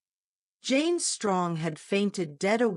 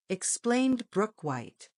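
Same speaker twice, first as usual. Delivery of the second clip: ordinary delivery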